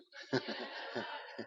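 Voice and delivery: short unvoiced-sounding chuckle; light laugh. Real, not acted. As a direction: laugh lightly after teasing the audience. Laughs after joking challenge.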